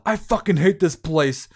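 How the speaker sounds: high, loud, slow furious blurt; mock furious yell; angry outburst. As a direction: explode in frustration. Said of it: "set him off".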